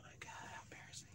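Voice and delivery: embarrassed whisper. Real, not acted. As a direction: whisper it to yourself, mortified. Embarrassed aside on air.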